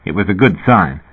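usual speaking voice

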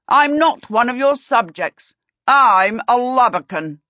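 high, loud declaration; defiant prince. A narrator's reading. Prince disowning the king's authority, loud defiance. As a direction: declare it proudly to the king.

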